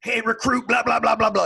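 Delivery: high, loud barrage in a recruit's face; screaming petty officer. Retold or imitated. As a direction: imitate a drill instructor's yapping yell. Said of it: "comes screaming, he's in our face".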